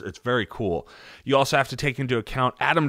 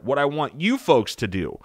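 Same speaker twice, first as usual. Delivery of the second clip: ordinary talk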